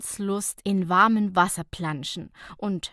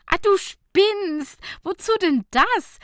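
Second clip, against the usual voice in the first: amused outburst; 11 semitones higher, 8 dB louder than usual. Acted. Nicola teasing Fabienne about the coffee machine, amused outburst. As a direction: cry out you're crazy in delight.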